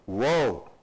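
a hint of a low, ominous movie voice; foreboding voice. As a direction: lightly imitate something deep and foreboding. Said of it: "sounds like this foreboding voice".

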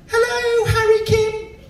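high, lilting sing-song hello; sing-song fan greeting. Retold or imitated. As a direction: croon the greeting like a smitten fan.

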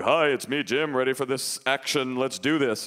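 lightly disguised man's voice; fake grown-up voice. Boy posing as Jim on the phone, put-on adult voice. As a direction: pass yourself off as an adult, overly casual. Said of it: "you can't use your real voice".

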